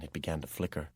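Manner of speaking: usual speaking voice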